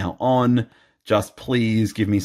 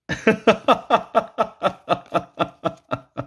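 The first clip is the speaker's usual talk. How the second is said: high helpless giggle; high laugh; 11 semitones higher than usual. Real, not acted. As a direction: burst out giggling. Laugh at duplicate cards.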